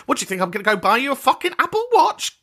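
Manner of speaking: high, fast incredulous rant; mock indignant. Retorting to someone wanting a pricey present, mock indignant. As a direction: rattle it off in high-pitched disbelief. Imagined retort to gift demands.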